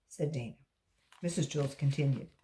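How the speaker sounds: ordinary delivery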